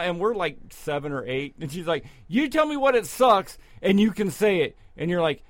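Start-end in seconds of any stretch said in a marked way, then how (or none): 2.3-4.8 imitating a scolding aunt, higher and louder; aunt losing it. Aunt scolding the kids for saying sucks, imitated yelling. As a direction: play the furious aunt scolding small kids. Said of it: "she would lose her shit".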